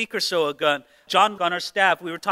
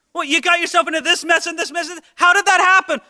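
higher, quick exasperated questioning; mock parent; 9 semitones higher, 3 dB louder, faster than usual. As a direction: grill the kid. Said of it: "Anybody who's ever had a teenager".

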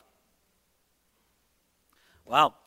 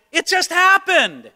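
higher, slow mock-innocent whine; teenager's classic excuse; 9 semitones higher, 3 dB louder, slower than usual. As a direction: shrug it off like a caught kid. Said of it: "It's the classic".

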